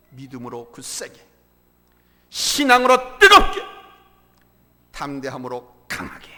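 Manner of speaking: low, spaced phrases with a loud burst on 뜨겁게; measured exhortation. Real, not acted. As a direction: set out each phrase after a pause, swelling only in the middle. Slogan first stated with measured pauses. (또박또박 끊어 낮게, "뜨겁게"만 힘주어)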